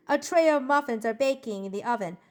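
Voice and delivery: usual speaking voice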